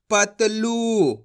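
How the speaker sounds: पतलू said very slowly, लू held long; long drawn ऊ. Shown as a demonstration. Teacher models the long ऊ, drawn out. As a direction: draw out the ऊ in पतलू. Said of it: "खींच के पढ़ा जाता है".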